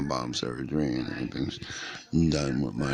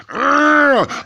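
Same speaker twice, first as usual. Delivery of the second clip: high, loud exasperated argh; frustrated argh; 12 dB louder than usual. Retold or imitated. After seeing a boy she likes, a loud exasperated argh. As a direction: let out a sudden growl of frustration. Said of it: "sat up and went".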